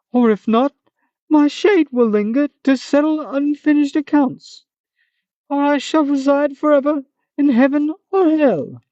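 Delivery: a hammed-up high monster; Manticore voice. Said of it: "this funny Manticore voice".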